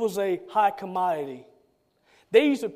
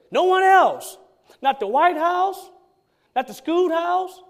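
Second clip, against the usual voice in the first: preached emphasis; 6 semitones higher than usual. Real, not acted. Preacher driving home a point.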